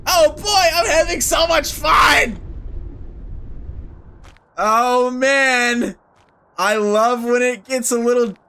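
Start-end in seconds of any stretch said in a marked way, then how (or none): 0.1-2.4 excited. Acted. Playing alone, overly cheerful.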